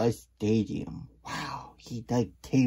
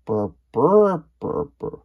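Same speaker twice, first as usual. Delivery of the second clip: loud chirpy burr; 6 semitones higher, 10 dB louder than usual. Acted. Character chirping sound.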